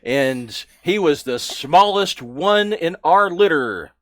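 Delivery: loud, scornful orc guard; mocking orc. Orc guard mocking the small recruit, loud scorn. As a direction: sneer down at the puny one.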